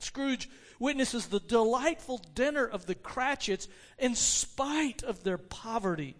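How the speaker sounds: usual speaking voice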